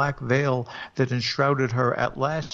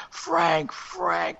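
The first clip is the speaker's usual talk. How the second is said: ghostly cry; 5 semitones higher than usual. Acted. Ghost wife cries to him through the door, high wailing call. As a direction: call out his name like a ghost in the wind. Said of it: "cries the voice with the dreadful familiar ring".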